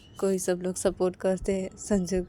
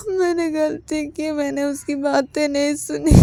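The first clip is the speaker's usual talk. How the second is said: crying confession; 6 semitones higher, 6 dB louder than usual. Retold or imitated. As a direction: sob out your mistake to dad. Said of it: "Sanju रोते रोते बोलते कि".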